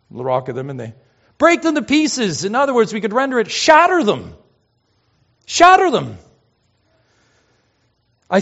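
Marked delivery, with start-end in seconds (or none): 1.4-2.4 high-pitched forceful command; urgent preacher's emphasis on pieces; emphatic. Shown as a demonstration. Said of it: "Emphasis just screams out. It's emphatic". 3.5-6.2 loud, high cry on SHATTER; ringing preacher's command, shatter pitched way up; emphatic. Shown as a demonstration. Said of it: "Emphasis just screams out. It's emphatic".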